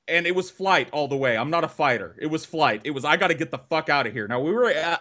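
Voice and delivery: ordinary talk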